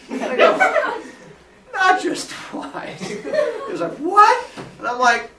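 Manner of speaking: slow, high, breathy through laughter; confessing while laughing. Real, not acted. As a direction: drag it out while giggling. Escalating confession of arrests.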